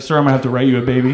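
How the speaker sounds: lightly voiced officer, low and fast; traffic cop. God as a cop to a speeder, lightly voiced officer. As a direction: rattle it off like a cop writing tickets.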